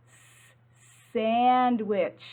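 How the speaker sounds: a long hissing "S-" before "sandwich"; teacher drawing out "S-" for kids; hissed S sound. Shown as a demonstration. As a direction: stretch the "S-" into a hiss, then say "sandwich". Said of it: "Say it with me".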